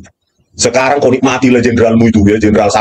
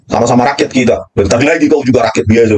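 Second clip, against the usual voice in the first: ordinary delivery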